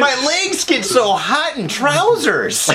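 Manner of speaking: put-on high, drawn-out child's complaint to mum; whining little boy. Boy begging his mom for a dress, mock whine.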